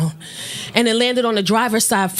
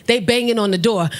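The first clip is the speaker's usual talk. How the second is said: ordinary talk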